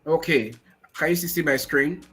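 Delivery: usual speaking voice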